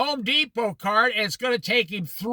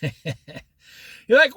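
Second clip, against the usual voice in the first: laughing; 4 dB louder than usual. Real, not acted. Laughs mid-story.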